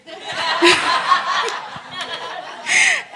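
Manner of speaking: a long, high, bright burst of laughter; real laugh. Real, not acted. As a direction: laugh freely at your son's words. Laughing at son's words.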